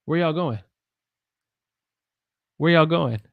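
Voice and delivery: quick casual run-through; fast natural speech. Shown as a demonstration. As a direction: run the words together, "Where y'all going?" with "going" dropping the g. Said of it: "if I say it faster".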